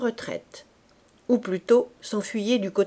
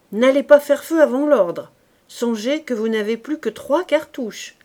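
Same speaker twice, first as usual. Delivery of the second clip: louder, higher command; stern order; 4 semitones higher, 7 dB louder than usual. Acted. Corporal to his squad, loud stern order. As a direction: lay down the law to the men.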